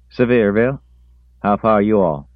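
put-on high, loud young country voice; country boy voice. A narrator's reading.

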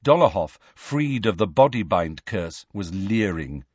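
plain reading voice

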